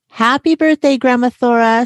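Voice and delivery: put-on high, bright-pitched children's greeting; cheery birthday greeting. A narrator's reading. Two children greeting grandma on her birthday, cheery.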